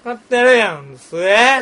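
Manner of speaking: slow, mock Kyoto "すえ" ending; mock Kyoto accent. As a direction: drag out a fake Kyoto sentence ending. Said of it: "って言われなあかんの".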